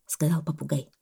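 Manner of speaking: usual speaking voice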